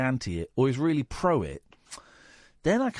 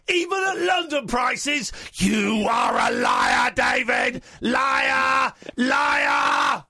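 furious shout; 9 semitones higher, slower than usual. Acted. Host mock-raging at caller.

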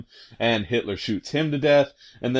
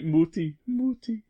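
put-on whimpering child; 10 semitones higher, 4 dB quieter than usual. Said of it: "starts going like".

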